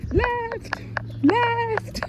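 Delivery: very high, drawn-out mock call of left; mock high scream. Runner screaming at people to move left, mimicked very high. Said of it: "Remember she was screaming".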